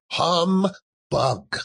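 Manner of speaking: drawn-out disdainful humbug, high then falling; scornful humbug. Acted. Scrooge waves off Christmas with a sneering humbug. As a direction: sneer the word away.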